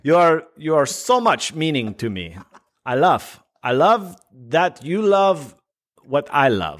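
put-on slow, broken-English foreign accent; Borat. Gushing to his co-host in the bit, Borat-like accent. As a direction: gush in a fake foreign accent. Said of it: "fucking Borat".

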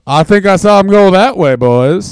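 homeless man. Acted. Homeless man tipping off the robbers, loud character voice. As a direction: play a homeless bystander calling out loudly. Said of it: "I would have been the homeless man".

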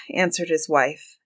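plain reading voice

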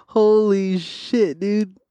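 real laughing disbelief. Real, not acted. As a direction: laugh it out slowly. Laughs at the situation.

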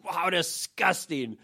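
slow, drawn-out mock revulsion; disgusted grandma. Grandma looking him over, slow mock disgust. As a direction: imitate an appalled grandma, stretching it out. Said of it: "gets this look and goes".

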